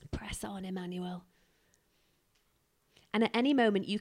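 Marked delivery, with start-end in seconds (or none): 0.1-1.3 hushed encouragement. Acted. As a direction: softly urge him onward. Father tenderly urging Son onward.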